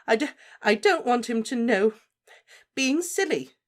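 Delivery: high, loud, stammering through tears; tearful. Acted. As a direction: cry it out, stammering. Molly still crying.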